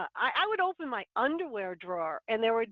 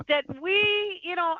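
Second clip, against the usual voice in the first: ordinary talk, nothing marked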